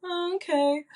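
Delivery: high, light mock compliance; imitating her past self going along with it; surprised agreement. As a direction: play along with a bright little "oh okay". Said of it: "And I was like".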